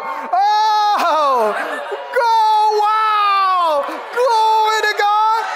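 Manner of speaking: high, loud cries of amazement; awestruck praise. Acted. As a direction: exclaim in drawn-out, rising wonder. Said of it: "and we'll all go".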